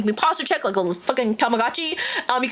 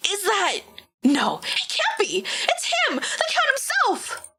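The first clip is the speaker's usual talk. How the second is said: much higher, slow astonished cry; awestruck gasp; 10 semitones higher, slower than usual. Acted. Onlooker spotting the Count arriving, much higher awestruck cry. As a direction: disbelief rising to awe.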